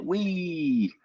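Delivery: long, high gleeful "wheee"; playful wee sound. Pretend. Teasing a driver about his tiny car, a high drawn-out wee. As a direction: pretend whoop of a joyride, drawn out. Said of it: "do you make the wee sound".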